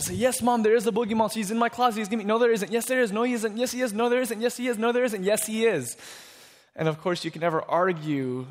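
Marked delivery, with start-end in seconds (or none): 0.1-6.0 higher-pitched rapid back-and-forth squabble; volley contradictions without a gap; acted childish insisting argument; bickering child and mom. Child and mother argue back and forth.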